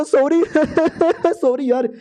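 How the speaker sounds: high, sheepish sorry with a giggle; nervous laughing apology. Acted. (घबराई हंसी के साथ माफी)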